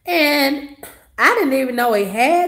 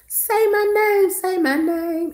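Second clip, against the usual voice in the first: high, sing-song call of a name; mother calling out; 8 semitones higher than usual. Retold or imitated. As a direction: call the kid's name like a lazy mom. Mother calling her son's name.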